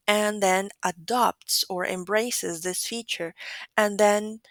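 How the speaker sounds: ordinary talk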